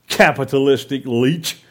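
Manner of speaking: ordinary delivery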